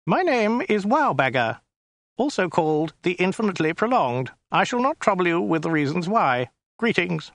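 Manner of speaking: high, thin alien voice; a small, prissy, weaselly official. Acted. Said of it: "it said in a small, weaselly voice".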